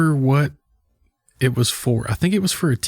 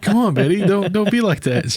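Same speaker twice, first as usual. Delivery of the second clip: mock pleading, pitched higher; playful higher-voiced appeal; Ryan Reynolds; 8 semitones higher than usual. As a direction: imitate an actor coaxing an offended star. Acting Ryan pleading with Betty.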